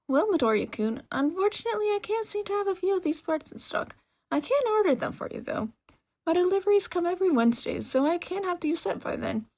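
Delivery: high, excited woman's voice; bubbly shopkeeper. Acted. Mechanic woman welcomes a young customer, bubbly and high. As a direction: gush at the customer eagerly.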